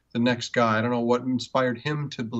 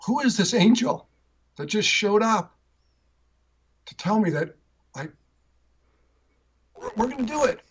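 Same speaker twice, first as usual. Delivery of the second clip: high, tearful retelling of a crying dad; 9 semitones higher than usual. Retold or imitated. Dad moved to tears by the stranger who showed up, high tearful voice. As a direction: let the voice rise up and choke with tears of relief. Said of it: "was crying like".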